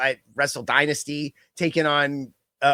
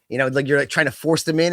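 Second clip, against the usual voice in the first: normal talking voice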